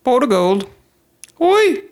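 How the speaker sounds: high hammed-up stage Irish; very high, badly faked Irish; bad Irish accent. Shown as a demonstration. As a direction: squeal it like cartoon Irish. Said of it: "my Irish accent is just".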